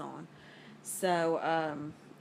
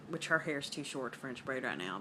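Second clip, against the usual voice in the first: normal talking voice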